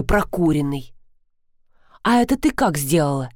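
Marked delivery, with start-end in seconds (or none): none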